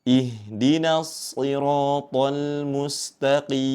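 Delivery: slow melodic recitation; Quran recitation. Shown as a demonstration. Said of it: "tetap dibaca tipis".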